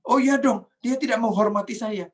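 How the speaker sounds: loud, high-pitched mock outrage; indignant retort. Acted angry retort to "kenapa marah. (membantah kesal dengan nada tinggi)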